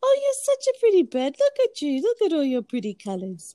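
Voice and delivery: high sing-song voice to the bird. Real, not acted. Talking to her pet bird.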